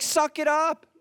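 high, emphatic mock scolding; tough-love friend. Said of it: "He's like".

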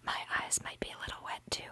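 fast, breathy whisper. Acted. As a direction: whisper it like a secret. Whispered aside.

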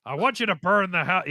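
lightly voiced cranky old man; a hint of a gruff grandpa; grumpy old man. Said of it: "I just want a cranky old man voice".

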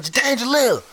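high-pitched mock yell of dad; imitated yelling. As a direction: holler a name as dad storming in. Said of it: "he came through the door yelling".

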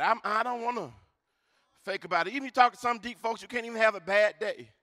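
ordinary talk, nothing marked

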